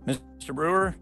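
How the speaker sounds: normal talking voice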